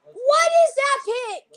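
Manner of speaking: high, slow, loud cry of disbelief; drawn-out indignant yell; loud outraged shout. Acted. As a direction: bellow it at the screen. Shouting at the draft pick.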